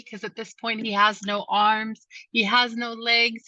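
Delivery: ordinary delivery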